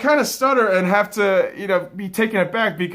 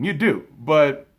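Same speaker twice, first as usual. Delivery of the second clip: ordinary talk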